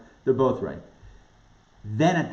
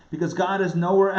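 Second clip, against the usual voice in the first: ordinary talk, nothing marked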